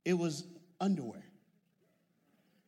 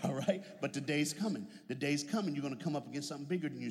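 ordinary delivery

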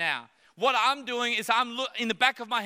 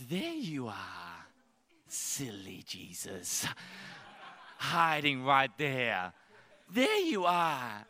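low, soft, slow sing-song with a laugh; mock toddler finder; 8 semitones lower, 4 dB quieter, much slower than usual. Believer finding Jesus like a toddler, soft and slow. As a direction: tease like a child finding daddy. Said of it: "we go".

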